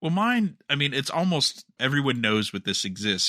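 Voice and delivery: ordinary talk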